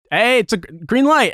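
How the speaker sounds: high-pitched impatient traffic call; retold call-out. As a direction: call out sharply to someone blocking the lane. Calling out at blocking scooter rider.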